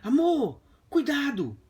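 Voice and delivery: high mock warning to the wife. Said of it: "eu falei com ela assim". (avisando a esposa, voz mais aguda)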